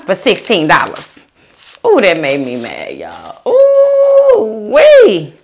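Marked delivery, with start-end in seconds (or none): 3.5-5.3 high, loud squeal of ooh wee. Real, not acted. Telling viewers about her bad bento box, high squeal. Reacting to her mad food story.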